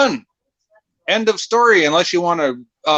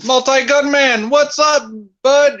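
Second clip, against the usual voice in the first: 1 hyped greeting; 9 semitones higher, slower than usual. Real, not acted.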